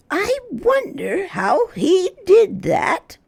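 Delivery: high, slow musing granny voice; puzzled, amused grandmother; wondering Grandma. Acted. Grandma to the children, slow and wondering. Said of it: "said Grandma".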